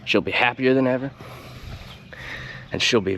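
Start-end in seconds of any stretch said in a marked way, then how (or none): none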